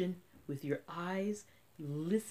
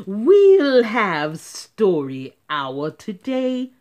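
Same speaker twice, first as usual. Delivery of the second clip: slow, very loud strict teacher; stern announcement; 2 semitones higher, 13 dB louder, slower than usual. Acted. Strict teacher announcing story hour. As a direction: announce it coldly. Miss Swamp speaks.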